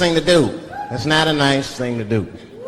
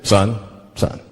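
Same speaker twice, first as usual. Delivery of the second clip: police officer; 10 semitones lower than usual. Acted. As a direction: pull a driver over with deep authority. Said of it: "If the police pull me over".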